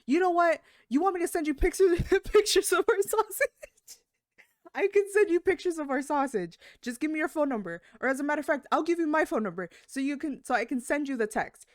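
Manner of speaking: high, loud, indignant rant; irate customer. Retold or imitated. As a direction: fire off an outraged customer's tirade.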